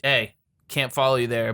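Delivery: ordinary talk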